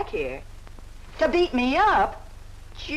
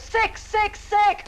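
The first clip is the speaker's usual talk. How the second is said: "Sick, sick, sick" spat out higher with venom; mocking chant of "sick" in a higher voice; scornful taunt; 8 semitones higher, much slower than usual. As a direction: hammer the word "sick" up high in disgust. Repeats sick with rising scorn.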